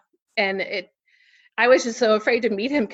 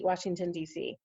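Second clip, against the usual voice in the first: ordinary talk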